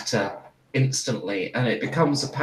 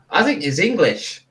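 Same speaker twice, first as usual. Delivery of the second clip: put-on higher, more confident Australian accent; Australian character voice, pitched up; recovered Australian accent; 5 semitones higher than usual. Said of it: "I think I've got it again".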